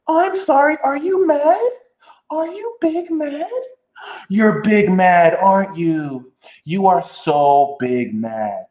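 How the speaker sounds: high, sugary fake-sorry teasing; sing-song condescending pity; mock sweet taunt. To someone projecting negativity, a mocking sweet taunt. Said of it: "just be like".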